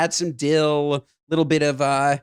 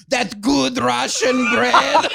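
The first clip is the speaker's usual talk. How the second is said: put-on slow, laughing kid voice; imitating son, laughing; 6 semitones higher, much slower than usual. Son answering his teacher at lunch, slow and laughing. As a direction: drawl it out proudly through laughs. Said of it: "what he says to his teachers".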